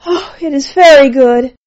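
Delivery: loud, high cry of pleasure; ecstatic cry. A narrator's reading. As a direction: cry it out in delight. Cries out in pleasure.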